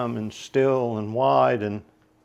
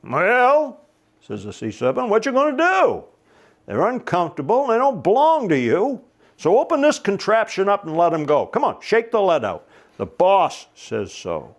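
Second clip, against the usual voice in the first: bossy sea serpent; 7 semitones higher, 4 dB louder than usual. Acted. Sea serpent bossing Charlie around.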